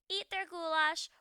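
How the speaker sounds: usual speaking voice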